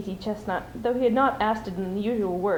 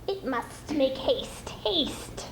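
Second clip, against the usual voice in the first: slow, soft creature voice rising high on the last haste; urgent Gollum; 4 semitones higher, 4 dB quieter, much slower than usual. Acted. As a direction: press him, hungry and impatient. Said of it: "said Gollum".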